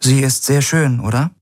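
casual question, carefully offhand; feigned casualness. A narrator's reading. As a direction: try to sound as unconcerned as possible. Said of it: "so unbekümmert wie möglich klingen".

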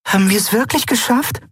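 high, tentative question; cautious. A narrator's reading. Nelen asks Endriel after the escape, cautious. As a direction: ask carefully in a small high voice. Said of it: "fragte Nelen vorsichtig".

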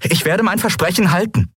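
high, rising insistence; determined. A narrator's reading. Captain insisting on bringing Kai back, determined. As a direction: vow it, pitch climbing.

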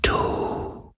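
very slow, breath-only whisper; faint whisper. A narrator's reading. Dying sea spirit asks Galen who he is, faint whisper. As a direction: whisper it like wind, drawn out. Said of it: "so leise wie der Schatten des Hauchs eines Flüsterns".

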